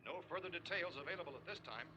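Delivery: distant radio broadcast; faint news from a radio speaker; radio in the background. Acted.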